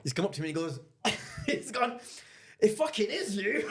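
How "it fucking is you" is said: laughing recognition. Retold or imitated. Fan recognising him, laughing.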